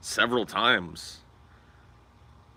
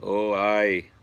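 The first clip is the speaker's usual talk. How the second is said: mock Irish; 3 dB louder than usual. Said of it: "that accent is really the hardest".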